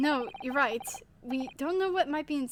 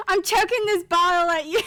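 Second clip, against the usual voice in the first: laughing threat; 4 semitones higher, 8 dB louder than usual. Real, not acted. Threatening character through laughter.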